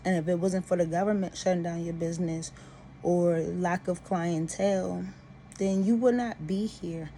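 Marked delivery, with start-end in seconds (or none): none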